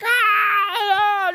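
high, loud imitation of a crying child; imitated toddler wail. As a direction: wail like a toddler refusing the toothbrush. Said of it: "just crying bloody murder".

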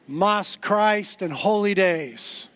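"Mas Christ and holy days" at half pace; deliberate slogan. Shown as a demonstration. Preacher resolving to keep it about that, slow emphasis.

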